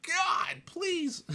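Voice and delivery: high-pitched, mock-desperate cry to the heavens; exasperated plea. Keeper pleading for noisy crickets to shut up, exasperated. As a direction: beg in exaggerated, squeaky despair.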